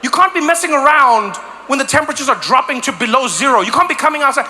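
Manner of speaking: usual speaking voice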